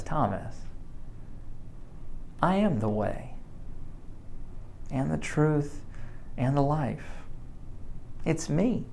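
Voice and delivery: soft and gentle, as Jesus speaking to Thomas; Jesus gently reassuring Thomas, soft tender voice; tender reassurance. Acted. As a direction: speak it softly and lovingly to a frightened friend.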